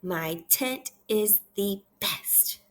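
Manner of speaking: very slow, word by word; measured repeat-after-me reading; slow read-aloud. Shown as a demonstration. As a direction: separate every word for a beginner. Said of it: "I want you to repeat after me".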